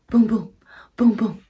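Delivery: normal talking voice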